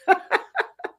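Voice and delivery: high burst of real laughter; amused laugh. Real, not acted. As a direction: laugh at your own question, high-pitched. Amused at her own question.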